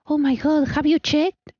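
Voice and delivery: hammed-up alarm at the milk; high mock alarm. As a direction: squeal the question in fake shock. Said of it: "replaying roommate quote".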